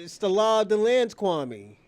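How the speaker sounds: normal talking voice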